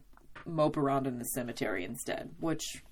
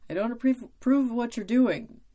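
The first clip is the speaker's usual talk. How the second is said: higher, louder, earnest caring warning; Oz's voice; 7 semitones higher, 5 dB louder than usual. Retold or imitated. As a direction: voice Oz disapproving but loving. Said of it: "Oz being like".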